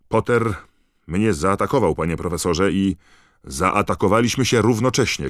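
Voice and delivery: loud, high-pitched accusation; shouted protest. A narrator's reading. Boys explain the fight to Snape, shouted protest. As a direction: protest loudly over each other. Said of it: "krzyknął Harry".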